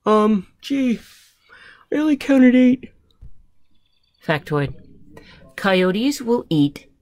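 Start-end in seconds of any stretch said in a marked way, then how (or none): 0.1-2.9 high, loud storybook character; hesitant character voice. Acted. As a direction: pipe up after a hesitant start. Character reports a low count.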